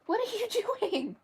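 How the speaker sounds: high-pitched call to a naughty cat; sharp loud name, then high questioning; scolding a pet. Real, not acted. Calling out to a misbehaving cat.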